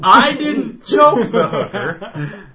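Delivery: loud, high protest breaking into laughter; emphatic denial. Real, not acted. Storyteller answering teasing about killing her, loudly insistent. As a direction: deny it loudly, amused and insistent.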